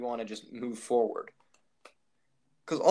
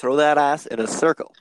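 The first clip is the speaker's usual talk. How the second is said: monotone reading; 11 dB louder than usual. Pretend. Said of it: "in, like, the most monotone voice".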